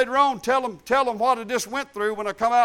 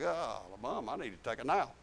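much softer and lower, drained and sleepy; worn out; 9 semitones lower, 12 dB quieter than usual. Retold or imitated. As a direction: sag into it like you can't lift a finger. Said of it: "you're like".

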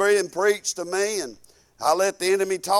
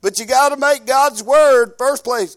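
louder, higher exhortation; exhorting; 5 semitones higher, 7 dB louder than usual. Real, not acted. Preacher urging the church, raised voice. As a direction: call it out to the pews.

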